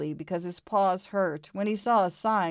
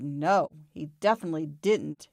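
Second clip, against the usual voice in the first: ordinary delivery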